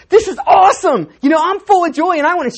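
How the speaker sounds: excited best man. Retold or imitated. Best man bursting out of the tent, loud excited cheer. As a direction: shout it like the happiest best man. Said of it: "he was like".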